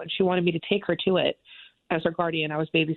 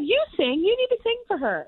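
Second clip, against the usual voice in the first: much higher, eager insistent voice; pushy little sister. Retold or imitated. Sister urging her to sing for the host, high and eager. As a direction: push her on like an excited kid sister.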